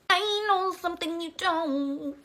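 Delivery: high, slow eerie singsong; lightly voiced possessed girl; creepy possessed voice. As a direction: taunt like a haunted child.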